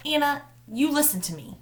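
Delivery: a mother putting her foot down; insistent, half-angry command; almost mad. A narrator's reading. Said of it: "She sounded almost mad now".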